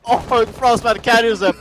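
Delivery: high, loud, drawn-out cry of surprise; excited shout. Real, not acted. Caster seeing the Frostmat catch someone, excited shout. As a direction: burst out at the sudden catch.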